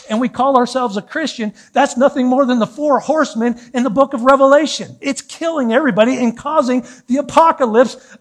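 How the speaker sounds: high, loud fiery preaching. Acted. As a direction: preach it at full force. Preaching builds to full force.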